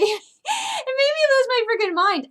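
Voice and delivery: real laughing yell, voice high up; high-pitched laugh-shout at a cartoon LeBron; laughing hard. Real, not acted. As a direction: burst out laughing as you say it. Laughing while recalling the trailer.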